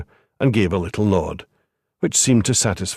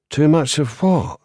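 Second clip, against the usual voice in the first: educated home counties English, pitched higher in mock innocence; educated home counties accent; 6 semitones higher than usual. A narrator's reading. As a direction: play dumb in a polished home counties accent. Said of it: "The voice was educated, home counties".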